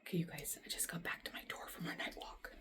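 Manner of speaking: hushed whisper by the nest. Real, not acted. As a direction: whisper so the birds aren't scared. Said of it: "whispering near the bird's nest".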